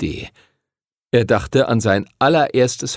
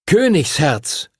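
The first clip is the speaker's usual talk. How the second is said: a higher, slow call; 6 semitones higher, slower than usual. Acted. Twin calls his dragon by its new name, slow call. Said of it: "rief Edward".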